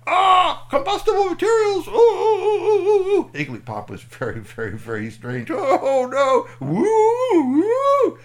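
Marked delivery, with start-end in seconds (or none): 0.1-3.3 very high, loud cartoon panic; hammed-up terror at a fire; panicked shrieking. Alien panics at the bonfire. 5.5-8.2 high, loud wailing; siren-like cries of alarm; hammed-up distress. Alien very distressed by fire.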